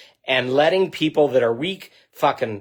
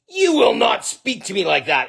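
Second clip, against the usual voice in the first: firm retold rebuke, raised and hard; fiery command; 8 semitones higher than usual. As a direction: lay down the line with fire. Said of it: "I got fucking fire in my eyes".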